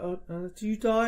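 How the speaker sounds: high, soft concerned question; upset. Retold or imitated. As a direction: imitate a friend softly asking, upset. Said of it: "she's really upset".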